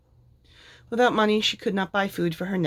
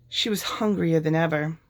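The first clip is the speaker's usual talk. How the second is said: usual speaking voice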